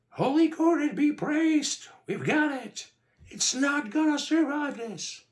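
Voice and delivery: very high, loud jubilant shriek; wild cheering. A narrator's reading. Sonya cheering as the monster is nearly beaten, wildly. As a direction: cheer at the top of a squeaky high voice. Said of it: "was cheering wildly".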